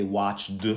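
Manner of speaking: WATCHED mispronounced as watch-duh; wrong d ending. Shown as a demonstration. Teacher showing another wrong way, d ending. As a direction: tack a voiced d onto WATCHED. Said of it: "We don't say watched".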